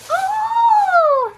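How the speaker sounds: loud, high delighted cry; excited gasp. Real, not acted. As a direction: shriek with delight. Opens her advent gift.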